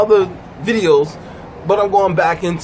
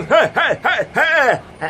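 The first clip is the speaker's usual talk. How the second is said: raised, repeated heys; higher put-on excited voice; imitated excited man; 6 semitones higher, slower than usual. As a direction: mimic a man yelping rhythmically. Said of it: "And then he'll go".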